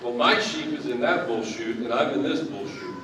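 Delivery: ordinary delivery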